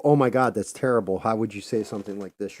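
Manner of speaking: exaggerated pearl-clutching indignation; mock outrage. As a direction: put on scandalized horror that eases off. Mocking hypocrites' show of outrage.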